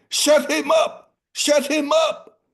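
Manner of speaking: high, loud royal yell; shouting king. Acted. King shouting out to his men, high and loud. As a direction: scream it at the guards. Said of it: "shouted out to his men".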